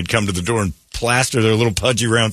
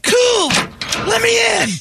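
put-on high, bright eager kid asking in; slower than usual. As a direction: squeal it like a pushy little kid. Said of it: "You just went".

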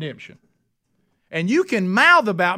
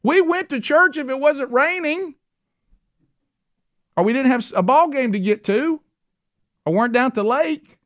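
mock pleading crowd; 8 semitones higher than usual. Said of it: "There'll be people shouting".

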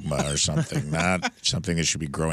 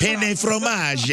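mock Italian accent; 14 semitones higher, much slower than usual. Joking French pun.

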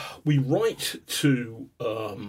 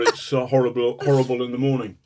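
normal talking voice

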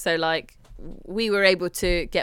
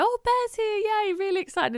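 high sing-song mock excitement; mocking mum's fake cheer; 13 semitones higher than usual. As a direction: parody her over-the-top cheerfulness. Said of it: "she'll go".

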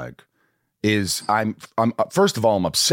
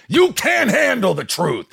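intense movie line; 13 semitones higher than usual. Pretend. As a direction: belt it like an intense screen villain. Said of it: "would I pay for you to be that intense".

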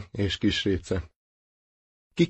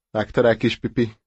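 ordinary delivery

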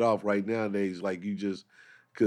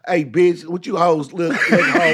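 loud, higher-pitched mock pimp; pimp talk; 7 semitones higher, 7 dB louder than usual. Comic addressing women on the phone, mock pimp bluster. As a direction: bark it like a would-be pimp.

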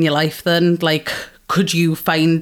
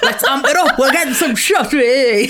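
high, laughing hype-up call; rowdy party cheer; 6 semitones higher, 3 dB louder than usual. Acted. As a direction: whoop it up for a round of shots. Acts out a rowdy shots cheer.

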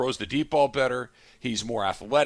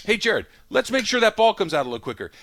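higher, tighter repeat of the same request; strained patience; 8 semitones higher, 4 dB louder than usual. Retold or imitated. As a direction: coach holding his temper a second time. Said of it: "Next week".

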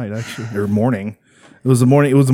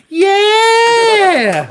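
very high loud scream; excited shout; 5 dB louder than usual. Retold or imitated. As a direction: squeal with delight. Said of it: "chick going".

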